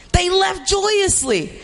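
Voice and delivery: joyously said high and slow; joyously lifted. Shown as a demonstration. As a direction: lift the word joyously with delight.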